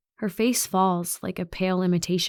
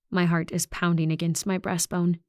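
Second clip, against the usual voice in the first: ordinary delivery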